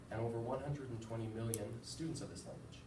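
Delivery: usual speaking voice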